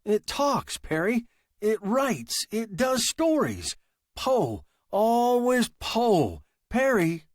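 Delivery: high, tense and rattled; a man on the edge of panic; agitated young writer. Acted.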